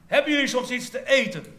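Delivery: called loudly across the water, high; calling out. Acted. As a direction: shout from the shore to the boat. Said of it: "En hij riep". (roepend over het water)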